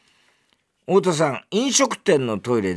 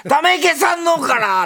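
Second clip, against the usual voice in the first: high, loud, drawn-out mock taxi call; silly over-the-top call-out; 6 dB louder than usual. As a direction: shout the destination slowly, like an idiot. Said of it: "バカみたいな言い方しなくていい". (バカみたいに甲高く伸ばして叫ぶ)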